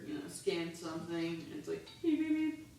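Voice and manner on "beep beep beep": higher beeps imitating the register scanner; mock scanner beeps. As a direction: beep like a cashier's scanner gone wrong.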